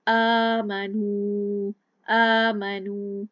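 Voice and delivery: आमनू with short मी and ya stretched two beats; आमनू slow and loud, mim short, final vowel long; correct aamanoo. Shown as a demonstration. Teacher shows the correct length, slow loud recitation. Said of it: "या को दो अलिफ का अदा किया".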